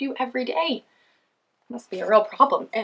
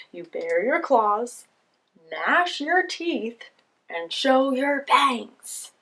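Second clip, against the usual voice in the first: fierce lion voice; 4 semitones higher, 6 dB louder, much slower than usual. Acted. Lion teaches fierce poses.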